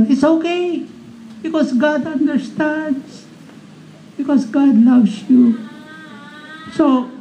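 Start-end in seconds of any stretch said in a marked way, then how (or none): 0.1-5.6 mock reassurance. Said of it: "You do all these practices".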